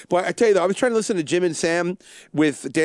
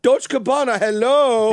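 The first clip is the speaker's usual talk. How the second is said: higher, louder mock flamboyant character; loud, high character quote; Bruno; 6 semitones higher, 5 dB louder, slower than usual. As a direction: play a comic film character, pitched up. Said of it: "you ever see the Bruno".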